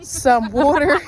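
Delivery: insistent restart. Real, not acted. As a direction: repeat it firmly over the giggling.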